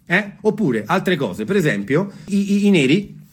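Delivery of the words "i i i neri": usual speaking voice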